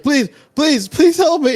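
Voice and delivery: very high, loud shrieking plea; man dragged down begs the players; desperate scream for help. Acted. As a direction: scream please as he sinks. Man descending into pit.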